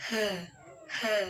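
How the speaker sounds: he as a breath-started "huh"; teacher modelling the H sound; h sound demo. Shown as a demonstration. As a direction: sound out he, twice with a pause. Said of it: "H dibaca".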